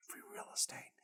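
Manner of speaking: hushed, voiceless aside; playful whisper. Pretend. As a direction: whisper it like a sneaky secret. Whispered aside quoting a catchphrase.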